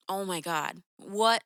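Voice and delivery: ordinary delivery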